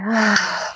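strong audible sigh through an open mouth. Shown as a demonstration. Coach shows the group how to sigh: strong open-mouth sigh. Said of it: "a strong exhalation with an open mouth".

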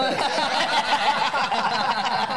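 big laugh; cracking up; loud laughter. Real, not acted. Laughs at joke.

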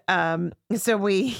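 ordinary talk

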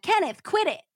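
high-pitched urgent Southern cry; acted exasperated shriek. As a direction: cry out to make him stop. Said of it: "Susan cried".

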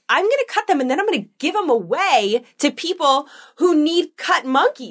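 high, stretched-out mock threat; retold parental meltdown; escalating threat. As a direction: threaten a child with overblown drama. Retold escalating threat to her son.

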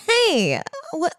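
high hype host call. Retold or imitated. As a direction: shout it like an emcee. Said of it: "It's like a ho-, an M C".

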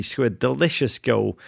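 ordinary talk

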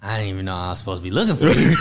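ordinary talk

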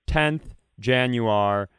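ordinary delivery

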